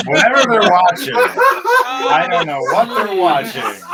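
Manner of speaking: high laughing talk. Real, not acted. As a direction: talk through giggles. Laughing at others' stream.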